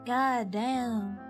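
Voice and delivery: ordinary talk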